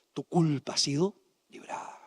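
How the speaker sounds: hushed whisper of forgiveness, voiceless. Acted. Preacher whispering God's pardon to Isaiah, hushed whisper. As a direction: breathe the words like a secret absolution.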